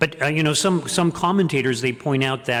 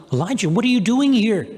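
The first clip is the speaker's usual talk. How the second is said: quick, high and loud question; God asks Elijah, high and loud; God's voice; 9 semitones higher, 4 dB louder, faster than usual. Acted. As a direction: demand it as God would. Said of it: "and then God says".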